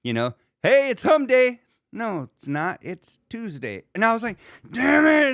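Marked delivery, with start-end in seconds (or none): 0.6-1.6 high, loud promo shout; mock hype. Reading a mistimed tweet aloud, loud mock hype. 4.7-5.3 high, loud mock-frustrated yell; exasperated outburst. Retelling a botched tweet schedule, exasperated yell.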